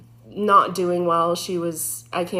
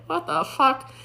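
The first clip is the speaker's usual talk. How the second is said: alarmed outburst; 7 semitones higher than usual. Retold or imitated. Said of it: "I'm like".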